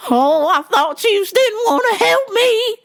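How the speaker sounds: high, loud, slow fake sob; hammed-up tearful lady voice; mock crying customer. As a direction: wail it like a guilty customer.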